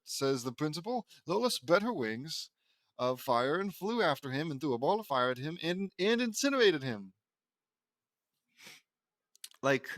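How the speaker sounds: ordinary delivery, nothing marked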